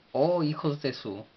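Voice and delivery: "Oh" punched high, then falling; high attack on "Oh", sliding down; emphatic exclamation. Shown as a demonstration. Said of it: "un énfasis para, para hacer más fuerte".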